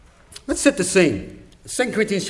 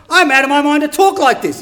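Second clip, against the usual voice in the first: raised, louder exasperated outburst; frustrated; 6 semitones higher, 5 dB louder than usual. Acted. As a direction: act out an exasperated apostle. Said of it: "he's frustrated".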